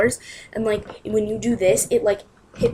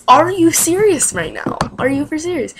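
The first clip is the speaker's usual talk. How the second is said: incredulous; 7 semitones higher, 6 dB louder than usual. Retold or imitated. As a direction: retell it as an indignant, raised-pitch challenge. Retelling her disbelief at wrong sizes.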